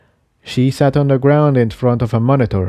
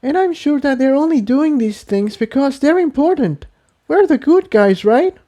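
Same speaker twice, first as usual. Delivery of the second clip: much higher, pleading reassurance; higher filly voice, anxious hope; Tiptoe, hopeful. Acted. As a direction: try to convince him.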